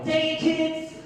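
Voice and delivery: high, mock-angry presenter; exasperated kids' TV host. Host blaming child viewers for rejection, hammed-up exasperation. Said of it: "act like I'm on a child's T V show".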